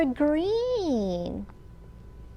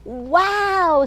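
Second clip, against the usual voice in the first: higher, louder wow of wonder; owl's wow; 6 semitones higher, 4 dB louder than usual. Acted. Owl sees the rainbow, a high, bright wow. Owl exclaims in picture book.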